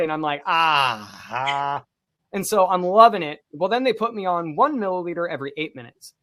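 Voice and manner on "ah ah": long, low "ah" of relief; blissful relief sigh. Retold or imitated. Patient feeling the morphine hit, acted blissful sighing. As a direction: sigh out as if warmth floods the body. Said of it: "the warmth would spread throughout my body".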